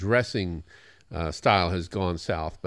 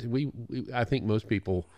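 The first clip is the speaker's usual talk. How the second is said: ordinary delivery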